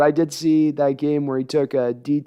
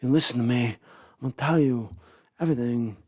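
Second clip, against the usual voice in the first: back down low; 4 semitones lower, 5 dB quieter, faster than usual. Shown as a demonstration. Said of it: "he goes back down".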